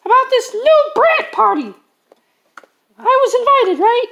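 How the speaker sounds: put-on squeaky high-pitched kid voice; bright squeaky cartoon voice; high character voice.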